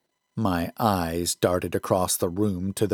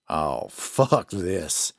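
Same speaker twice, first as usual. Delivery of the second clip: low, slow, fed-up drawl; a weary lawman giving up; gruff sheriff; 5 semitones lower, 3 dB quieter, much slower than usual. A narrator's reading. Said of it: "Wheatley said".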